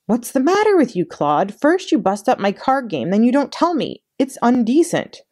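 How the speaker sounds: put-on a boy blowing up at his sister; erupting. A narrator's reading. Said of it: "Jamie erupted".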